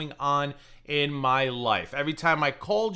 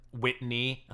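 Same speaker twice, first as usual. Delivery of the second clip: normal talking voice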